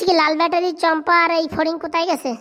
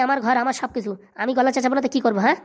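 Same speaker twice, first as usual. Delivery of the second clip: usual speaking voice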